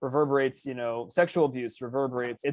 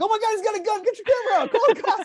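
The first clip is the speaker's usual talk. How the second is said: high, loud frantic yelling; mock panic; 6 dB louder, faster than usual. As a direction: raise a hammed-up alarm in a high voice. Couple panics at seeing gun.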